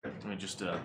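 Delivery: ordinary talk, nothing marked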